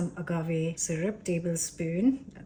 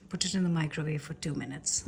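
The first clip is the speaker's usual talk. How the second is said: ordinary talk, nothing marked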